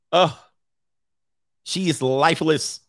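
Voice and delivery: ordinary delivery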